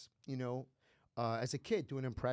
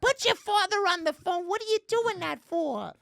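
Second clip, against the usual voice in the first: much higher, louder, exasperated aunt voice; scolding aunt; 10 dB louder than usual. Retold or imitated. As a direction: scold the boy for the prank. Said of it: "And she'd be like".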